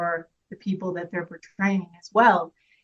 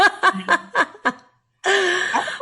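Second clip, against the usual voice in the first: laughing; 12 semitones higher, 4 dB louder than usual. Real, not acted. Still laughing.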